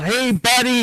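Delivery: high, loud mocking buddy voice; mock fond greeting. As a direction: sneer at the cutesy moment. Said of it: "B B eight comes rolling out".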